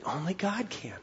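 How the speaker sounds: hushed whisper, letting it sink in. Shown as a demonstration. Preacher whispers the point to the congregation, hushed. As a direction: breathe the words out, no voice.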